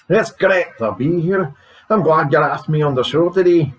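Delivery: guest in a put-on Scottish accent. Scottish guest thanks radio host, Scottish accent. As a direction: greet the host with a Scottish brogue. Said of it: "is every Scotsman drink".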